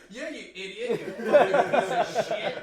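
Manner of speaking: loud laughing mock insult; swearing tirade. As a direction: bellow the insults, half laughing. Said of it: "but he's like".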